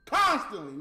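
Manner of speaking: Constantly said loud and emphatic; stress on constantly. Real, not acted. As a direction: hit Constantly hard. Stressing the word.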